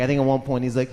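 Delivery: ordinary talk, nothing marked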